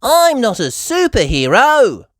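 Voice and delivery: put-on high, loud little girl; Peppa's voice. A narrator's reading. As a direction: correct the teacher proudly.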